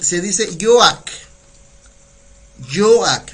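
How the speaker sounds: yoak said slowly, two clear syllables yo-ak; slow pronunciation demo. Shown as a demonstration. Teacher showing students how yoak sounds. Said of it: "se escribe yoak y se pronuncia yoak".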